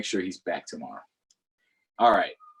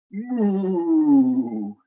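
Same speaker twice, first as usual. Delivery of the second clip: a loud, drawn-out storybook moo; cow moo; 3 semitones higher, 6 dB louder than usual. Acted. Reading to children, a loud playful moo.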